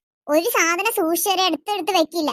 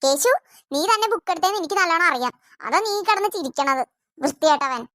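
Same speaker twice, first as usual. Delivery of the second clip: ordinary talk, nothing marked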